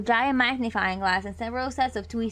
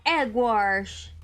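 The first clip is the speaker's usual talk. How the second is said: usual speaking voice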